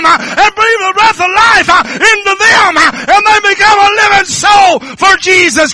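high, loud, tearful whooping sermon; crying preaching shout. Real, not acted. Preacher shouting to the congregation through tears that it can happen. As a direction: pour it out like a weeping revival preacher.

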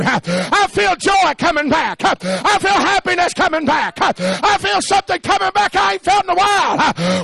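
preaching at full force. Acted. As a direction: preach it out to the whole church at full voice. Revival preacher building to climax.

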